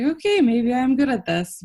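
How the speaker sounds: half-joking realization of being good; mock surprise. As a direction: act surprised at your own talent. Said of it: "I was like".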